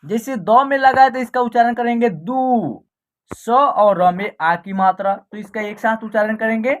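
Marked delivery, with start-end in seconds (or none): 2.1-2.8 दू with the ऊ held long; long vowel. Shown as a demonstration. Teacher showing द with the matra, दू held long. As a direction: draw out the vowel in दू. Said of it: "आप long तरीके से उच्चारण".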